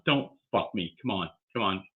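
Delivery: usual speaking voice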